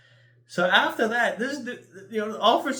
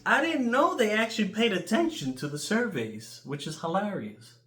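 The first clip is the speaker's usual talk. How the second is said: normal talking voice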